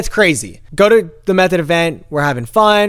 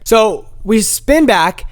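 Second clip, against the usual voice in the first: ordinary talk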